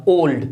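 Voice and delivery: ओल्ड in one unbroken syllable; one single sound. Shown as a demonstration. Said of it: "ओल्ड एक बार में निकला". (ओल्ड एक बार में, बिना तोड़े)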